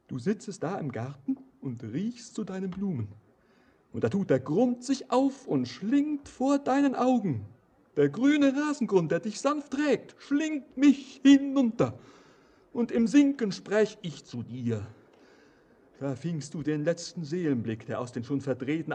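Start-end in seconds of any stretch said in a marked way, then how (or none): 3.9-14.9 mounting dread. Acted. Stranger telling Anna of the ground swallowing him, building in dread. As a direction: let the vision swell until it swallows you.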